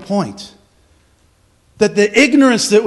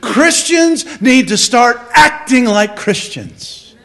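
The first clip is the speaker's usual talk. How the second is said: "acting" stretched and punched; stress on acting; 3 semitones higher, 4 dB louder, slower than usual. Real, not acted. Preacher challenging believers, heavy emphasis on "acting". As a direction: hit the word "acting" hard and slow. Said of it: "I emphasize that word acting".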